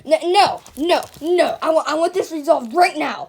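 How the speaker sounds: loud, insistent protest; no, no, fix this now; demanding outrage. Acted. Customer demands the manager fix it, loudly.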